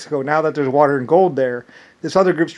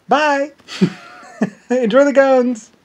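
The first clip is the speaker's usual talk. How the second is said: high-pitched, breezy goodbye with a laugh; playful parting call, pitched way up; mock cheery farewell; 10 semitones higher, 4 dB louder, slower than usual. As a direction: sing out a jokey send-off. Acts out cheery farewell when leaving.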